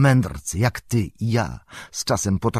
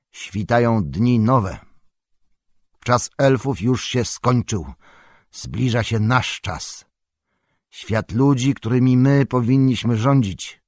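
lightly hammed-up solemn declamation; declaiming; 3 semitones higher, 4 dB louder than usual. A narrator's reading. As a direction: proclaim it like an old prepared oration. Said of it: "zaczął deklamować tak, jakby wygłaszał z dawna przygotowaną mowę".

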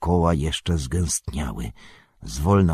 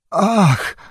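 high, loud cry of dismay; anguished moan; 13 semitones higher, 5 dB louder than usual. A narrator's reading. Elf sees the monster coming, high anguished cry. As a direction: wail out in dread. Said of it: "jęknął Legolas".

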